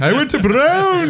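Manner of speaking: very high, slow, put-on posh voice; hammed-up upper-class accent over a Brown degree; posh King's English. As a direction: say it like a snooty Ivy League grad. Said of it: "I speak the King's English".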